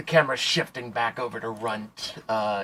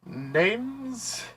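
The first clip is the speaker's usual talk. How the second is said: NPC voice; 11 semitones higher than usual. Acted. Player voices NPC questioned.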